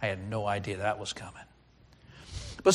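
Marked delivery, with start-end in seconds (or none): 0.0-1.4 low, hushed, defeated sigh; soft, deflated aside in prayer. Acted. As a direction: mutter it wearily up to heaven. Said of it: "we sigh, look to heaven and say".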